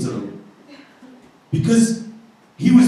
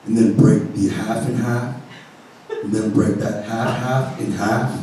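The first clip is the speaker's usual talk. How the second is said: stoner voice; 8 semitones lower, 5 dB quieter than usual. Retold or imitated. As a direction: counter clerk drawling a warning. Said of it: "super stoner".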